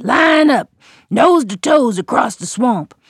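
loud high order; calling out. A narrator's reading. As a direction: shout the command down the line. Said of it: "the gator called out to his family".

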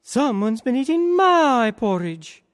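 an indignant mother bear, shocked; high, put-out mama bear. A narrator's reading. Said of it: "the mama bear ... and said".

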